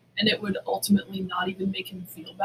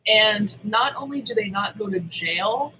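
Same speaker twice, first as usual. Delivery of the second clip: ordinary delivery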